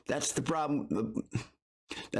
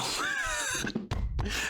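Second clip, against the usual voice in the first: high laugh. Real, not acted. Reacting to the clip.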